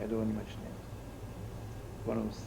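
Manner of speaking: slow, hushed whisper of discretion; whispered aside. Real, not acted. Preacher to the room, a slow confidential whisper. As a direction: lean in and whisper it.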